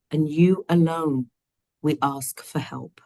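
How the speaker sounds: normal talking voice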